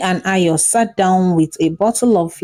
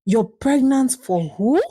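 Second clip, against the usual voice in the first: shocked question; 6 semitones higher than usual. Acted. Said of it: "stood up in shock and worry".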